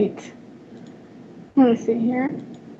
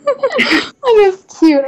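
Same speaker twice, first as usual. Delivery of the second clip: laughing; 12 semitones higher, much slower than usual. Real, not acted. Laughing at cute picture.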